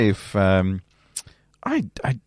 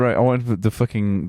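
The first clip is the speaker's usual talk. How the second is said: ordinary talk, nothing marked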